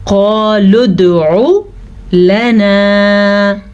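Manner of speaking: लना drawn out long on the alif; stretched alif. Shown as a demonstration. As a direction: hold the ना of लना. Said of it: "खींच के पढ़ा". (मद के साथ लंबा)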